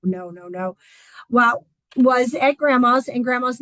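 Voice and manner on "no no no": softer, low, dismayed "oh no no no"; sinking dread. Retold or imitated. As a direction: let it drop away as your stomach sinks. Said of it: "my stomach kinda going".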